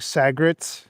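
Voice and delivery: "sagrets", squashed into two syllables; slurred regional pronunciation. Shown as a demonstration. Streamer showing how they say cigarettes, squashed to "sagrets". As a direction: say cigarettes as "sagrets", middle vowel dropped. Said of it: "I'll say things like".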